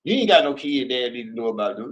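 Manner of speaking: usual speaking voice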